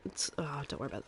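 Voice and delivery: normal talking voice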